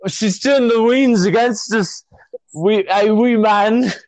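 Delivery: a hint of a Scottish accent, slow; lightly put-on Scottish accent, ending on a laugh; attempted Scottish accent. An American pressed on a podcast to attempt a slightly Scottish accent.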